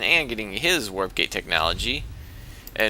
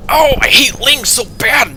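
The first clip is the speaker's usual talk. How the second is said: much higher, louder, slow squeaky whine; frustrated probe; 13 semitones higher, 10 dB louder, slower than usual. Pretend. Caster voices a probe attacking the Nexus, squeaky whine. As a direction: voice the angry little probe. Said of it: "He's like".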